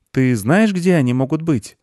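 put-on high boy's question; high-pitched young boy asking gently; little boy. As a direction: ask in a high child voice.

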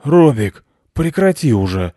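grumpy call from half-sleep; irritated sleepy owner; displeased, sleepy. A narrator's reading. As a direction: call out crossly, half awake. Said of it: "недовольный сонный голос".

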